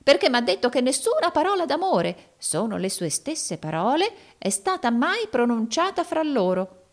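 high-pitched, pressing character voice; agitated schemer. A narrator's reading. Arturo explaining the rival's words to Rodolfo, agitated high voice. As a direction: argue back anxiously in a high voice.

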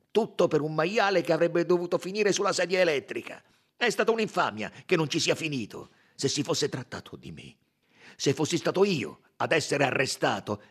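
loud, indignant tirade; heated protest from the accused; Italian suspect, outraged. A narrator's reading.

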